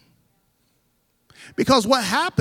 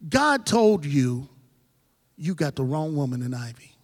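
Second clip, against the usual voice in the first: insinuate like the devil in his ear; slyly voiced enemy lying about his wife; the enemy's whisper; 6 semitones lower than usual. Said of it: "the enemy comes to me now and says".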